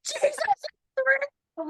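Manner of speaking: very high, drawn-out cry; squeezed, slow cry after a laugh; exultant squeal. Retold or imitated. Wife repeats her news to husband, in a high exultant squeal.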